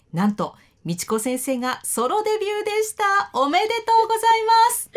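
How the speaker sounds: building to a loud, high congratulation; rousing high-pitched cheer of congratulations; loud announcement. Real, not acted. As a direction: proclaim the big news at full voice. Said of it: "大きな声で言わないでください".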